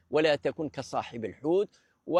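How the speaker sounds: normal talking voice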